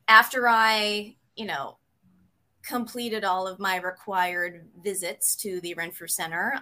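ordinary talk

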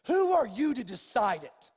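loud, re-enacted rebuke; indignant challenge. Preacher defending a grieving family, retold indignant challenge. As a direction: push back hard at their authority. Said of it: "it rose up in me like".

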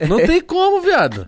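high squeaky teasing voice; teasing disbelief. Real, not acted.

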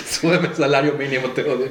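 hammy villain, laughing through; silky menacing villain. Pretend. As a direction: play a laughing cartoon villain. Voicing capitalist pig villain.

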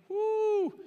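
high delighted woo; a sudden high cheer from the pulpit; excited whoop. Real, not acted. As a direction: whoop with joy at the verse. Reacting to verse.

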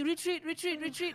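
high, frantic repeated retreat call; frantic retreat order. Retold or imitated. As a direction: squeal it like a panicked teammate. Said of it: "the only thing I hear is".

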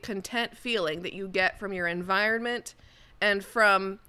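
ordinary talk

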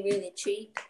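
usual speaking voice

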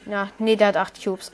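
usual speaking voice

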